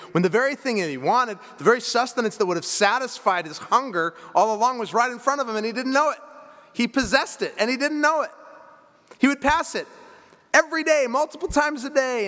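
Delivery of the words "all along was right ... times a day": rising intensity. Acted. Preacher building to the point.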